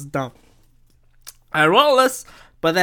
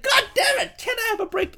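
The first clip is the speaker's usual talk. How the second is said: exasperated squeal; 5 dB quieter than usual. Retold or imitated. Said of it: "I'm just like".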